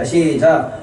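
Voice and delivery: normal talking voice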